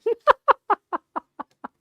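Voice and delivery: loud, high run of laughter. Real, not acted. Quilter laughing at lining the fabric up again, loud laughter. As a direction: crack up, loud and high, then tail off.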